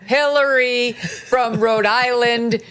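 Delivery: slow, sarcastic emphasis. Acted. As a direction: drag it out mockingly. Mocking, drawn out.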